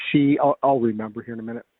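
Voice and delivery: ordinary talk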